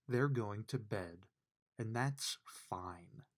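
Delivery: ordinary delivery, nothing marked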